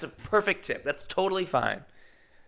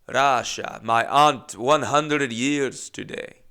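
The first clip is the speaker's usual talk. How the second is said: put-on slow, hammy Russian accent; retry the old lady's Russian accent, drawn out; thick Russian accent; 4 dB louder, much slower than usual. Comic redoes old Russian passenger's news, slow imitated Russian accent. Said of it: "I don't know what that accent was".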